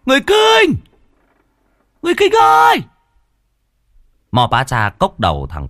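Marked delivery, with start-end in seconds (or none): 0.1-0.8 very high, louder shout; loud call. Acted. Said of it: "trụm tay lên miệng mà hét". 2.0-2.8 very high, loudest long call; full shout. Acted. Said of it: "trụm tay lên miệng mà hét".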